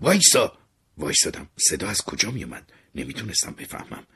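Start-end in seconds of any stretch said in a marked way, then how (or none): 0.0-0.6 loud, high yell to halt; urgent raised cry; shout. Acted. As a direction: shout it out sharply to stop someone. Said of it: "یکی داد زد".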